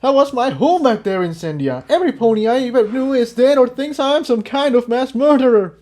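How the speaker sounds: high, hurt voice; bitter, grieving young pony. Acted. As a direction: let the grief show. Jigsaw bitter grief.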